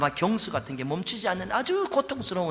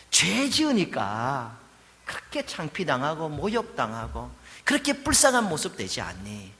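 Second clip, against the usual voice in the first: ordinary delivery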